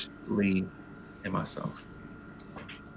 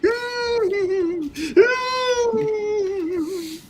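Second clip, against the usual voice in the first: high laugh. Real, not acted. Laugh at crying story.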